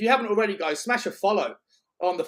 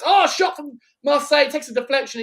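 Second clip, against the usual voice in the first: excited commentary; 9 semitones higher, 6 dB louder than usual. Real, not acted. Live commentary shot.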